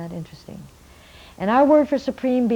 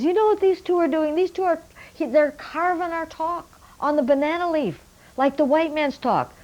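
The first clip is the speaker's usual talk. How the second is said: much higher, eager call to the whole village; excited elder's announcement; 9 semitones higher, 3 dB louder than usual. Retold or imitated. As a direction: proclaim it to the gathered village. Elder addresses village meeting.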